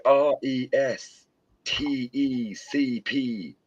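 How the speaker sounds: spelling R E S T E C P letter by letter, slowly; slow spelled out. Retold or imitated. Quoting the film to friends, spelling out slowly. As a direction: drag out R E S T E C P one letter at a time. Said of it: "Does anyone know what that spells".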